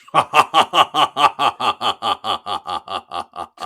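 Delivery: villain laugh, loud; sinister bad guy cackle; evil chuckle. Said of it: "That was a very good bad guy laugh".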